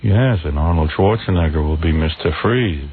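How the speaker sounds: low, slow guest impression; George Clooney. Imitated guest plugging the Batman film, low slow drone. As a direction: recite it in a low, dragging drone. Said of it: "this is how he talks".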